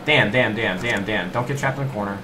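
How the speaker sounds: normal talking voice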